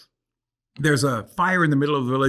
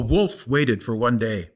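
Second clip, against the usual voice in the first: ordinary delivery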